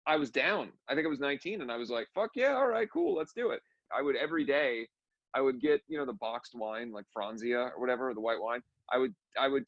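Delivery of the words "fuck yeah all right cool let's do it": high-pitched, eager retold yes; much higher, keen and up for it; eager agreement. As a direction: jump on it like a game teenager. Retelling his eager younger self's reaction.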